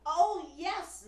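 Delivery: high, loud eager denial; overeager lie. Acted. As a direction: blurt out the lie. Pinocchio lying.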